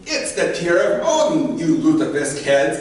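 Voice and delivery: put-on loud, high-pitched old woman telling off; hammed-up feisty granny outburst; scolding old Goda. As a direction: scold the fools at full voice. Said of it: "and she goes".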